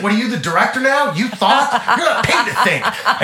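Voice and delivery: high, loud berating; hammed-up indignant scolding; mock outrage. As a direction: imitate a star chewing out an underling, fully committed. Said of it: "he's really selling it".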